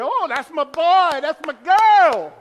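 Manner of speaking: slow, loud, beaming parent's boast; proud parent. Retold or imitated. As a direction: brag on your kid for the whole bleachers. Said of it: "and they're".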